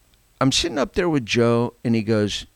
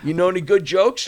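laughing; 8 semitones higher than usual. Real, not acted. Laughing after a heavy story.

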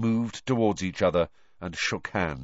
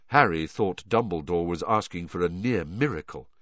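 ordinary delivery, nothing marked

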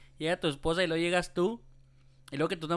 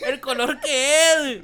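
laughing; 6 semitones higher, 8 dB louder, slower than usual. Real, not acted. Laughing at wall color.

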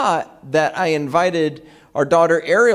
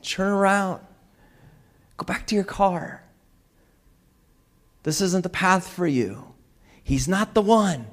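higher, softened, clearly spaced guidance; God; 4 semitones higher, 4 dB quieter than usual. Retold or imitated. God speaking clearly to us, gently directing. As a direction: guide softly, pausing between each instruction. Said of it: "if God's voice was so clear".

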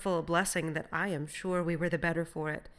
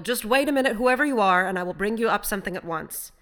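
loud, raised, eager assurance; urgent calling; 4 semitones higher, 10 dB louder than usual. A narrator's reading. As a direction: call down to him to wait. Hurriedly calling out to the castaway.

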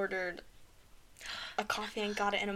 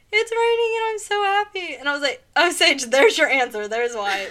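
high, loud happy exclaim; excited; 6 semitones higher, 10 dB louder than usual. Retold or imitated. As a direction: gush about the rain.

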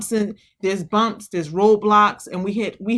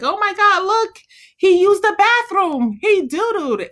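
very high, gushing delight; mock parental excitement; 12 semitones higher, 4 dB louder than usual. Parents in a funny movie, high and gushing. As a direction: gush like a proud new parent. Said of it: "the parents are like".

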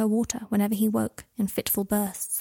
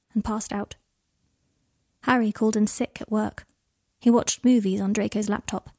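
ordinary delivery

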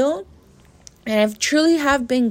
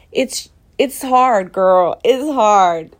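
1 real frustration; 7 dB louder, slower than usual. Real, not acted. Overwhelmed by childhood hurt.

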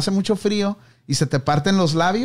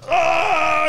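hit hard crying out; 10 semitones higher than usual. Retold or imitated. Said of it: "el güey le hace".

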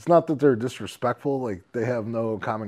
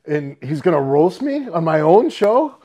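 higher, louder outraged rant; mock outraged rant; 7 semitones higher, 9 dB louder than usual. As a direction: rant it in disbelief. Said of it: "And then he's like".

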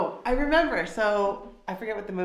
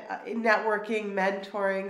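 ordinary delivery

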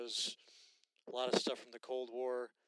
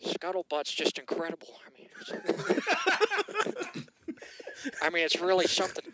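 Owen Wilson impression; 5 semitones higher, 11 dB louder than usual. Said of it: "Do an Owen Wilson ad".

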